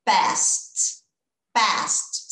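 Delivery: "passed" said as one syllable, pést, ending in a crisp T; teacher models "passed" with a clipped final T; ending T sound. Shown as a demonstration. Teacher showing the class how the past ending sounds, crisp T. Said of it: "Som de T mesmo".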